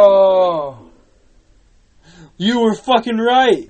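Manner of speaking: very loud, high outburst; startled shout. Real, not acted. Player conceding a friend was right mid-scare, shouted. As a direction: shout it in shock.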